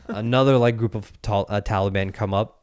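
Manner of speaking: usual speaking voice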